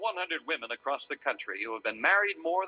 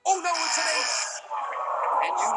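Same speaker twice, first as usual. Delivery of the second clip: high-pitched cry of bad luck; dismayed exclamation; 11 semitones higher, 4 dB louder than usual. Real, not acted. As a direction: exclaim in dramatic game show dismay. Host calls a losing card.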